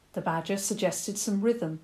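usual speaking voice

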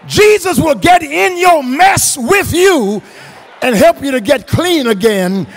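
loud, high, driving pulpit delivery; impassioned preacher shouting to the congregation; full-force preaching. Real, not acted. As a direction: build the list and hammer each phrase home.

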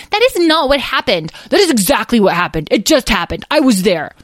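put-on loud, high childish contradiction; heated bickering. Two draglings dispute what just happened, heated bickering. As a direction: insist hotly, punching each phrase. Siblings bicker back and forth.

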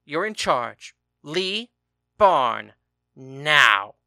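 building to a loud furious shout on Barn and Now; yelling. A narrator's reading. Furious husband ordering his husband out to the barn, shouting. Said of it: "yelled at his husband".

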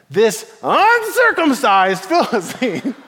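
hammed-up indignation. David to King Saul about the giant, exaggerated indignation. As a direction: stretch out the insult with relish. Acting David's outrage at Philistine.

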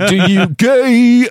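high, loud, slow mock through laughter; laughing, high mock echo of a heckle; laughing squeal. Real, not acted. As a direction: squeal the words out while cracking up. Laughing mockery of partner's heckle.

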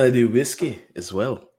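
light mock Scottish lilt; lightly attempted Scottish accent; put-on Scottish accent. Host chats about Scotland's whiskey, light Scottish accent. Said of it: "In Scotland".

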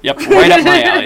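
high squawky turkey gobble; gobbling turkey voice. Pretend. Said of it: "good turkey impression".

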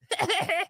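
high, rapid imitation cackle; put-on burst of tittering; mock laugh. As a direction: mimic someone's silly giggle. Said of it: "and you're like".

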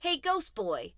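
high, loud taunt; insulting. Acted. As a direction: call out the name as a jeer. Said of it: "say them like an insult".

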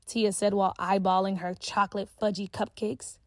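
ordinary delivery, nothing marked

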